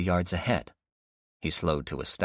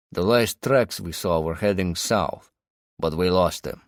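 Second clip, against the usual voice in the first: put-on Russian accent; 4 dB louder than usual. A narrator's reading. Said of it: "heavy with a Russian accent".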